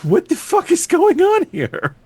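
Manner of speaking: high-pitched, incredulous mock outcry; baffled viewer. Viewer reacting to the spider story, baffled. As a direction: blurt it out high, in bewildered disbelief. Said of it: "just to sit there and go".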